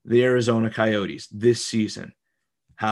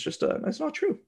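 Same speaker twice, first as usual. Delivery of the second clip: high falsetto; 6 dB quieter than usual. Pretend. Mock squeaky denial banter.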